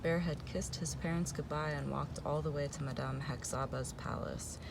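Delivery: ordinary delivery, nothing marked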